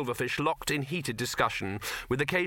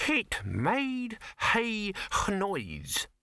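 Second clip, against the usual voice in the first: put-on very slow, high, over-refined accent with added H's; affected genteel accent; 8 semitones higher, much slower than usual. A narrator's reading. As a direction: restate it grandly in a sham genteel accent. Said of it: "create H's where nature never intended".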